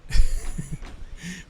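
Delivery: loud breathy laugh bursting out; sudden airy cackle; burst of laughter. Real, not acted. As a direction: laugh out loud, caught off guard.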